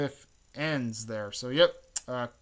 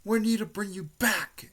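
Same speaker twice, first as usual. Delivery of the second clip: high-pitched pleading call; acted urgent plea; 9 semitones higher, 4 dB louder than usual. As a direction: plead after a friend who is leaving. Retold plea to departing friend.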